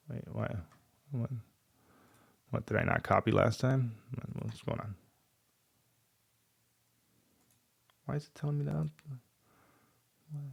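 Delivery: quiet, puzzled muttering at the screen; muttering to himself. Real, not acted. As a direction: mumble under your breath, baffled. Confused muttering at buggy code.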